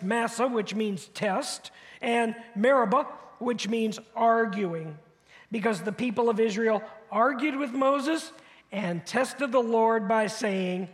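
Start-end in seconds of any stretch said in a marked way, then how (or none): none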